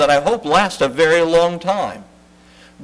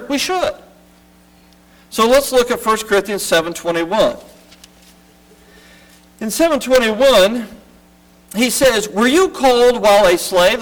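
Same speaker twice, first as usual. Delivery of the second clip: rising sermon; 6 semitones higher than usual. Acted. Pastor urging the church to act, preaching with rising force. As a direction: preach it up to the congregation.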